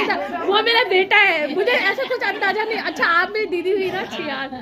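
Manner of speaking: high, laughing pretend astonishment; giggly mock surprise. Jokingly introducing relatives to viewers, high giggly mock surprise. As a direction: play dumb about her own family, giggling.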